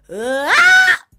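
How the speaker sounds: loud high shouted "ah"; mock strained note. Coach mocking the strained note. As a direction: mimic the strained belt. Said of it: "It shouldn't have been".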